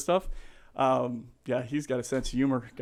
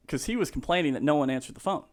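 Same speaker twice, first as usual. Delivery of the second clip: normal talking voice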